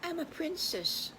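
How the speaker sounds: high, soft princess voice; dainty princess. Acted. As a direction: answer softly and high, like a storybook princess. Princess answers softly in story.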